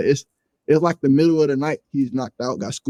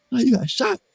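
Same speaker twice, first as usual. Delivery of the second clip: high-pitched imitation of a shocked friend; surprised question; 11 semitones higher than usual. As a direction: jump up in pitch, mimicking disbelief. Friend's retold shocked reaction.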